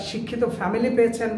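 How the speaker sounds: ordinary talk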